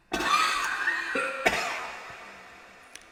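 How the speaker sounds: harsh real coughing fit, high and loud; loud spluttering coughs; violent coughing fit. Real, not acted. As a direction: choke and hack on the burning spice. Coughs from spicy food.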